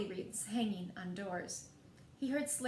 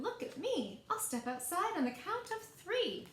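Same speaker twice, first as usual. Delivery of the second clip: shouted; 7 semitones higher than usual. Acted. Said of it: "He twirled and shouted".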